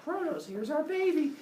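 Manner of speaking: high sing-out mother voice; proud new mother. Acted. Rhea showing Kronos their newborn, high proud mother voice. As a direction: present it in a lifted, cooing pitch.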